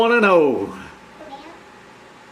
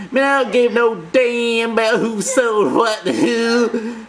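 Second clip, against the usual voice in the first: higher, slow, exaggerated tough-guy voice; rude mock quote; 5 semitones higher, slower than usual. Mocking the host's rude way of talking. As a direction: sneer it out like a mock grouch. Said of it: "gotta say stuff like".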